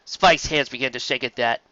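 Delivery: ordinary delivery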